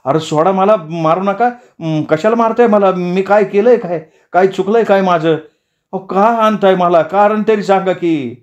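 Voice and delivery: high-pitched panicky pleading; frightened protest. Acted. Young man attacked by villagers begs to be released, frightened high protest. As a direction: protest in alarm, begging for a reason. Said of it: "घाबरलेला तो तरुण या हल्ल्यानं ओरडायला लागला".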